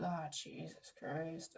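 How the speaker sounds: a slow, very soft groan of dismay under the breath; exasperated mutter. Retold or imitated. To herself when the doctor orders blood tests, a soft exasperated mutter. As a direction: sink into a weary, muttered "oh, Jesus Christ".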